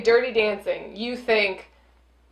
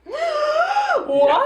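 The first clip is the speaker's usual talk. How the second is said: high, loud, shrieked what; hammed-up shocked shout; mock shock; 4 dB louder than usual. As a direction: overplay stunned disbelief. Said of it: "you were supposed to be like".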